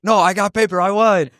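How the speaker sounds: high-pitched insistent winner; mock triumph. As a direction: play the kid eagerly claiming victory. Acted kid claiming the win.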